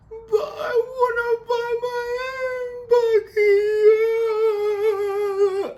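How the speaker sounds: high, loud, drawn-out mock wail; hammed-up crying, wailing out each word; imitated crying tantrum. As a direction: bawl it like a sobbing, overwrought woman. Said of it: "exactly what she sounded like".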